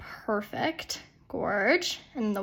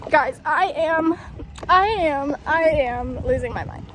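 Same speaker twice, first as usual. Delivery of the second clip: real frazzled outburst; 5 semitones higher, 4 dB louder than usual. Real, not acted. Distressed after a bad round.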